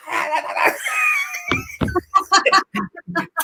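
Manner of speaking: a real burst of high, shouting laughter. Real, not acted. Teller cracks up retelling.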